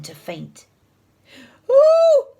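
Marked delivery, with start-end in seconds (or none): 1.7-2.3 very high, loud squeal; squeak. A narrator's reading. Teacher about to faint, high squeak. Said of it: "squeaked Mister Nurden".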